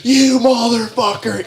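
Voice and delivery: high, drawn-out imitation of a screaming driver; mock scream. Old lawyer type out his window at him, mock scream. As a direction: mimic a road-rage scream without real volume. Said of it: "and he's just screaming".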